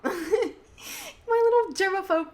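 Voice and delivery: laughing at herself. Real, not acted. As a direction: giggle it out, a bit embarrassed. Laughs at her own habit.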